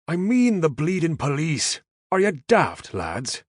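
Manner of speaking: high, loud Irish brogue, fed up; exasperated Irish. A narrator's reading. Caleb berating the befuddled Yanks, exasperated Irish brogue. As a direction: call them daft with open exasperation.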